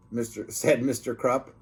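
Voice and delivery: ordinary delivery, nothing marked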